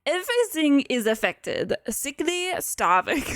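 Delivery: attempted French accent, coming out German; muddled French accent. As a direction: play a jerk doctor in a bad French accent. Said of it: "trying to be, like, French".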